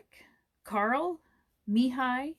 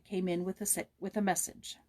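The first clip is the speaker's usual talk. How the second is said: ordinary delivery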